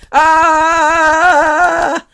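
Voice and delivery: loud, high, long held "aah"; chest-beating jungle holler; Tarzan yell. Shown as a demonstration. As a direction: holler like Tarzan while tapping. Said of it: "beat your chest like you're Tarzan".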